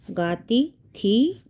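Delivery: गाती and थी stretched apart, each rising; slow dictation. Shown as a demonstration. Teacher writing out the answer, slow dictation. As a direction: say गाती, pause, then a lifted थी.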